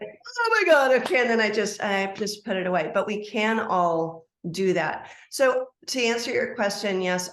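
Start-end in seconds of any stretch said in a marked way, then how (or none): 0.0-1.2 much higher, louder astonished exclaim; amazed gasp. Retold or imitated. Retelling her own reaction to a perfect card guess, high amazed exclaim. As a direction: pitch it way up in delighted shock.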